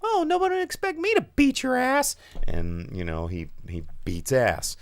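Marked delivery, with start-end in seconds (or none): 0.0-2.1 high, sweet, falsely harmless voice; mock innocent voice. Imitating the unsuspecting hero, high and innocent. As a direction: play the harmless guy who's about to win. Voicing the lovable fighter's line.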